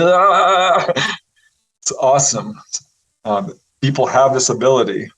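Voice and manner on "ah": high, long buzzing "ahhh"; drawn-out exhilarated shudder; energized cry. Shown as a demonstration. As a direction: voice being charged full of energy. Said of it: "You come away all energized".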